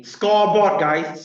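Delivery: loud proud shout of scoreboard; boast. Acted. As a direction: crow Scoreboard like a winner. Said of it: "boasting to the class".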